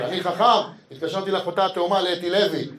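ordinary delivery

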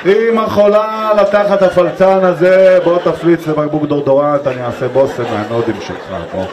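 put-on slow, loud, over-the-top baby cooing; doting mother. Wife cooing at the farting baby, hammed-up. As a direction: gush at the baby like a besotted mom. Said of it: "אשתי קמה".

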